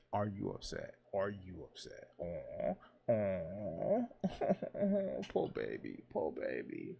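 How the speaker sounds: put-on low, babying coo with a chuckle; mock sympathy. Host teases people upset by words in a game, mock sympathy. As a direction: taunt with fake pity, dropping low.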